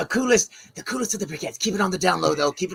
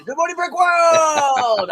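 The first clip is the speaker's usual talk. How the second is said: slow, higher, stretched-out show opener; drawn-out greeting; 6 semitones higher, much slower than usual. Acted. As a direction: draw out every word like an announcer.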